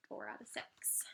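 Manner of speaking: whisper. Shown as a demonstration. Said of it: "whispered aside".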